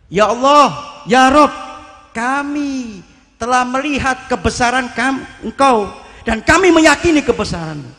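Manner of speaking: the saint praying aloud amid the storm; fervent loud prayer. Retold or imitated. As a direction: proclaim God's greatness over the storm. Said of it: "Doanya cuma itu saja".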